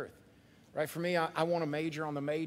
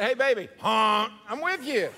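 playful cheer; 5 semitones higher, 8 dB louder than usual. Pretend. Playful greeting in hallway.

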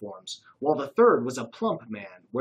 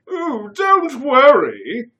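high, loud, slow fluting British coo; fake British accent; 12 semitones higher, 12 dB louder, much slower than usual. A narrator's reading. Curtis reassuring sneezing Dave, fake British accent. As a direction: drawl it breezily like a toff. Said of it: "said Curtis".

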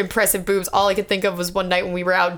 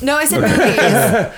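laughing; 3 semitones higher, 5 dB louder, slower than usual. Real, not acted. Laughing correction.

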